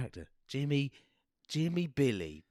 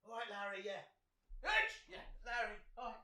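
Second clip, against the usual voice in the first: far off mic; 5 semitones higher, 15 dB quieter than usual. Acted. Sends character off, off mic.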